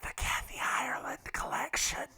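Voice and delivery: breathy whispered punchline; whispered aside. Real, not acted. Host slipping in a joke about sheets, whispered. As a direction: whisper it like a secret brand name.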